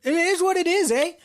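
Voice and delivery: playful put-on Canadian ending in "eh?"; mock Canadian voice. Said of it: "Jordan Peterson into all the characters".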